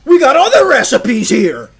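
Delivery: snapping at her husband over a recipe, overacted; mock angry wife. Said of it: "She's like".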